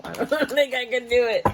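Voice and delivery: high laughing giving up. Real, not acted. Reader laughs to viewers that she can't go on, laughing. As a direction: laugh it off, unable to go on.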